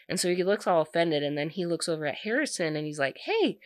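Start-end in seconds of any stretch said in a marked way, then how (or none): 3.2-3.6 put-on high, eager drunken greeting; overeager hey. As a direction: call out a bright hey. Drunk guy greets bodyguard.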